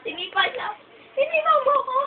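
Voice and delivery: high mock-hurt protest; playful high whine about a kick; high-pitched complaint. Complains that Ichiro kicked them.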